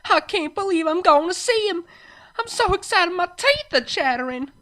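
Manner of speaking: very high, loud gushing; excited fangirl. Acted. Farmer bouncing in her seat before the show, excited.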